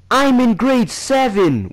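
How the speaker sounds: put-on high, drawn-out squeaky kid voice; mock squeaky boast. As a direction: squeak it out, imitating a kid acting big. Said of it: "with my squeaky ass voice".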